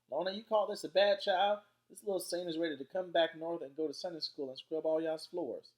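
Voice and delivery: high, loud grandma holler; shouted. A narrator's reading. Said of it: "while she shouted".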